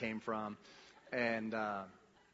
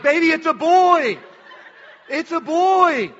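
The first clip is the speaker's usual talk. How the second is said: much higher and louder, shouting with joy; excited shout; 19 dB louder, slower than usual. Retold or imitated. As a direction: announce it like a thrilled new dad, the last bit slowed and laughing.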